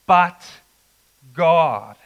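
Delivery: "but God," with "but" hit loud and held; emphatic but God. Shown as a demonstration. Preacher contrasting death with mercy, loud emphatic stress. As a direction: punch "but" loud, then land "God".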